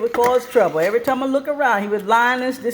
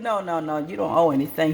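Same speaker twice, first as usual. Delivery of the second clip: stepfather voice; 7 semitones lower, 5 dB quieter than usual. Retold or imitated. Deep-voiced man on phone.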